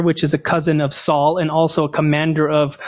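ordinary talk, nothing marked

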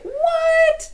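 very high surprised shriek; shocked high squeal. Retold or imitated. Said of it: "they're gonna be like".

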